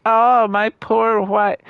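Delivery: high, slow, mock-pitiful sigh of a whiner; mocking whiny widower. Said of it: "I love how he's like".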